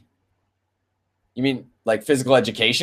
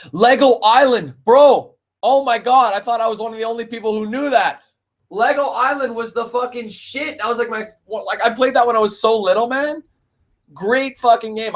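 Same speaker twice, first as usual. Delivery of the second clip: excited; 8 semitones higher than usual. Real, not acted. Excited recognition of favourite game.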